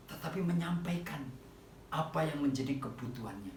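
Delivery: normal talking voice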